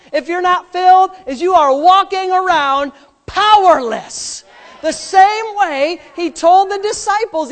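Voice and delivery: high, loud pulpit delivery; full-force preaching. Acted. Preacher to congregation on walking around powerless, full force.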